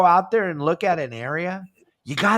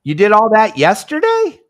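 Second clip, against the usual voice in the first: higher, louder, mock amazed question; retold disbelief; 6 semitones higher, 9 dB louder than usual. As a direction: replay your own shock at his answer. Retold talk with a club member.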